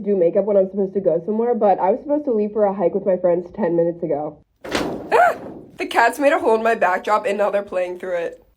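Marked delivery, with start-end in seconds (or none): 4.6-5.4 sudden high yelp of surprise; startled shriek. Real, not acted. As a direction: shriek in shock. Cats tore the backdrop.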